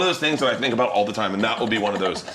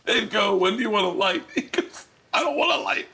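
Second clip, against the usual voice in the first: laughing through story; 13 semitones higher than usual. Real, not acted. Retelling Todd bit, cracking up.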